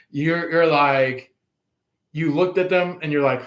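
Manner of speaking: ordinary talk, nothing marked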